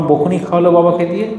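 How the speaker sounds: ordinary delivery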